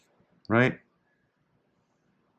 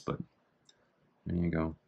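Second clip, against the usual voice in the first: normal talking voice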